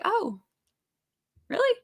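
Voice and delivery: high surprised oh really; mock surprise. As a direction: act amazed. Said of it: "I was like".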